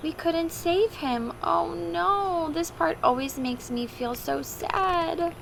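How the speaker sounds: ordinary talk, nothing marked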